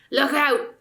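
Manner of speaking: gruff, loud surly warning. A narrator's reading. Dwarf warning strangers in the dark, loud and surly. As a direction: snap it out like a grumpy dwarf. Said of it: "in a surly voice".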